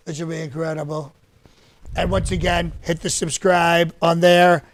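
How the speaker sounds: usual speaking voice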